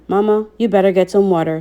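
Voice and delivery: ordinary delivery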